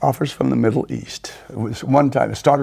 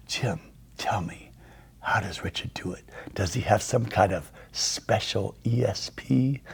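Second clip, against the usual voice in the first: confiding aside; 3 semitones lower, 9 dB quieter than usual. Retold or imitated. Boss takes Jim aside to ask about Richard, confiding. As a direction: ask it quietly, puzzled, as a private aside. Retold aside, taken to one side.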